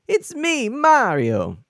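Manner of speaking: loud, high-pitched Mario catchphrase; Chris Pratt as Mario. Acted. Streamer retrying the impression, loud high Mario voice. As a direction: belt it out high like a game mascot.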